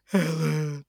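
high, drawn-out wail of the name; mock anguished cry. Husband saying Helen one last time, mock anguished cry. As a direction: let the name out as a long mock whimper. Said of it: "he utters the immortal line".

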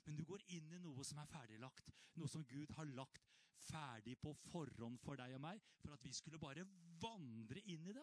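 normal talking voice

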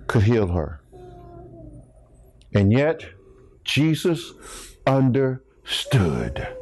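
low-pitched, measured sermon storytelling; grave pulpit narration in short phrases; solemn preaching. Real, not acted. Solemn, low retelling of healing.

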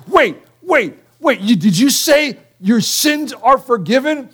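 high, incredulous double-take; stunned Pharisee, can't believe it; shocked disbelief. Retold or imitated. Pharisees hearing Jesus forgive sins, incredulous.

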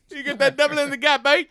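high, loud Aussie parody with a laugh; horrible Australian accent. Pretend. Host ribbing a ballplayer about a double, mock Australian accent. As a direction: joke around in a bad Australian accent.

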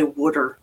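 "wooder" said softly the Philly way for water; Philly pronunciation. Shown as a demonstration. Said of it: "I don't have a thick Philly accent. I don't say".

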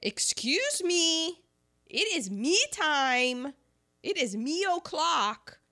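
high, loud, stretched-out demand; hammed-up entitled interruption; demanding diva. Fiancee bursting into his business meeting, demanding and entitled.

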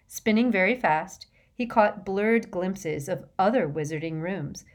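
ordinary delivery

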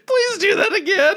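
high, through real laughter; laughing. Real, not acted. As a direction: talk while cracking up. Laughing while teasing.

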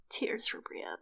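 hushed, heartbroken whisper; tearful whisper. Real, not acted. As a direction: breathe it out, close to tears. Moved by the sad passage.

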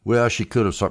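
ordinary talk, nothing marked

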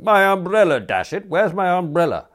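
high, loud exasperated outburst; outraged demand. A narrator's reading. Youth interrupts Smith's directions, loudly exasperated. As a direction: cut in, loudly demanding it back.

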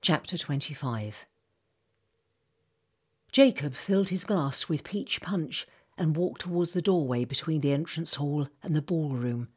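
usual speaking voice

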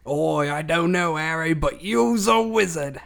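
a put-on Australian twang; mock Australian accent. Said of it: "talking like you're from Australia".

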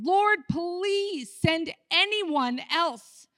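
high, slow, whiny plea; pleading Moses. Acted. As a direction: beg God to send someone else. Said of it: "pleaded again".

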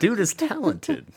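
high laughing voice. Real, not acted. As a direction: say it through laughter.

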